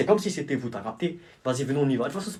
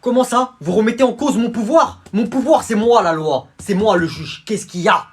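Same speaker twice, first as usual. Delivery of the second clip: indignant yelling judge; angry shouting; 7 semitones higher, 9 dB louder than usual. Acted. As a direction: roar at the lawyer. Judge challenged, losing his temper.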